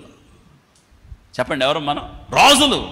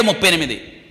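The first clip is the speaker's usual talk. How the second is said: ordinary talk, nothing marked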